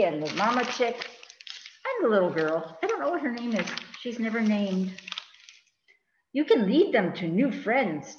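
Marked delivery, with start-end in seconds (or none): none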